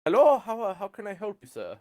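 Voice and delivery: high-pitched, welcoming salesman; game master's eager shop clerk; salesman in character. Acted. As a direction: greet a customer in a high sales voice.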